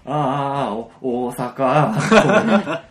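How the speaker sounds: mock excited clamor. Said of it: "騒ぎ出して".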